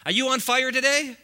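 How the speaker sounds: preacher rallying the congregation; rousing call. Real, not acted. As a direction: fire up the room.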